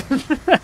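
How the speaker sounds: short high-pitched laugh; high cackle. Real, not acted. Gamer gleeful mid-chase, high cackle. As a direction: cackle high, straight after the cry. Laughs after battle cry.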